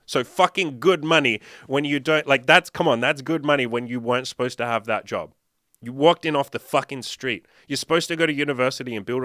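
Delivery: worked-up, punchy enthusiasm; emphatic amazement. Real, not acted. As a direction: drive the point home loudly. Marvels emphatically at friend's unlikely success.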